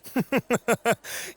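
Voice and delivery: high, mocking little laugh; smug laugh. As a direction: laugh down at the rival, amused. Rivals taunting each other.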